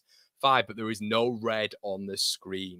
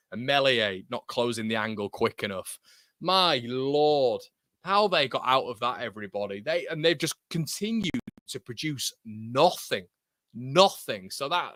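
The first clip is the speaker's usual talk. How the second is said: ordinary talk, nothing marked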